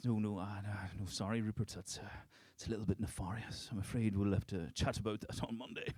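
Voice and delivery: soft, quiet put-on posh English accent. Sailor on the phone to posh mate Rupert, quiet posh English accent. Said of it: "When did I get a posh prick".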